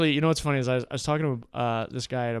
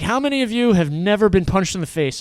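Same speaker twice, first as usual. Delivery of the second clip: raised, challenging coach voice; loud sensei; 5 semitones higher, 7 dB louder than usual. Retold or imitated. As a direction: call it out to the class. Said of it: "He's like".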